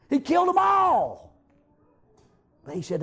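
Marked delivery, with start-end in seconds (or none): 0.1-1.3 high, slow shout landing hard on all; preaching shout. Acted. A preacher telling his congregation of the slain prophets, shouting. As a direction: hammer the final words home.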